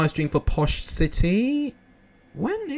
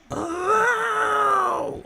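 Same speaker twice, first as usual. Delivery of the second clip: very high, held squeal; Jamie Carragher. Shown as a demonstration. Showing viewers a pundit's goal reaction, squealed imitation. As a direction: imitate a commentator's long falsetto goal cry. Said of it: "Jamie Carragher one".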